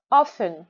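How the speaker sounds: "Often" with a strong first syllable; "Often", big beat then small beat; stress on first syllable. Shown as a demonstration. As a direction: lean on the OF in "Often". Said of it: "Gros rond en premier".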